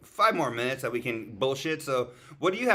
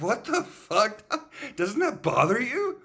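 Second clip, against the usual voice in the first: shocked; 10 semitones higher, slower than usual. Retold or imitated. Shocked reaction to friend eating mustache.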